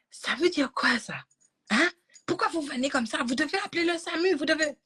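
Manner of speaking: aigu, reproche du médecin; médecin agacé imité. Retold or imitated. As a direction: singer le médecin qui gronde. (imitation aiguë du médecin agacé)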